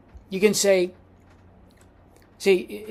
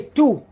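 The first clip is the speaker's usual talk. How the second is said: "tu" lifted higher and set apart; stressed tu; 6 semitones higher, 3 dB louder than usual. Shown as a demonstration. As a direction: hit the word "tu" as the key word. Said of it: "You use the word".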